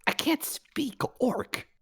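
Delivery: hushed, breathy whisper. Acted. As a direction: whisper it nervously. Whispering in character.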